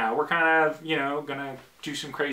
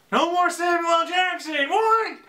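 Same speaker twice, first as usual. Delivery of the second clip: very high, shouted mock protest; mock outraged disbelief; 13 semitones higher, 3 dB louder than usual. Fan protests Nick Fury losing his voice, mock outraged shout. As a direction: squawk it in exaggerated fan dismay. Mock outcry over changed character voice.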